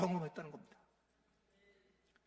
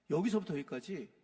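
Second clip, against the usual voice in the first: ordinary delivery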